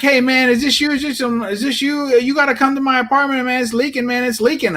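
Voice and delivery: high, urgent mock panic; high-pitched frantic retelling; imitated frantic neighbor. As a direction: act out an agitated plea for help. Neighbor frantically reports leaking tank.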